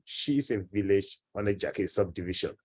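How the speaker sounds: ordinary talk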